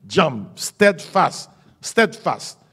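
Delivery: steadfast stretched very slow, sound by sound; preacher drawing out steadfast; slow English steadfast. Shown as a demonstration. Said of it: "ma bonne créole là".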